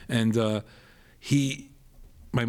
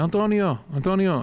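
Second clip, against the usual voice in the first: high, louder call through the house; 7 semitones higher, 4 dB louder than usual. Retold or imitated. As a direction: call out for someone in another room. Mom calls out for her son.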